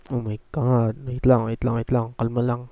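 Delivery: ordinary delivery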